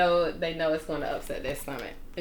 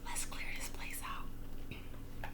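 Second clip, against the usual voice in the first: slow unvoiced whisper; 17 dB quieter, much slower than usual. A narrator's reading. Burglar plotting the robbery in a slow whisper. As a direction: draw it out silently, plotting. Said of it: "whispered the first burglar".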